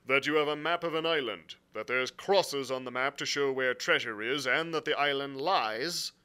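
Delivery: blunt sea captain. Acted. Captain explaining his orders to the gentlemen, bluntly. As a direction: state the grievance firmly and formally. Captain's dialogue beside narration.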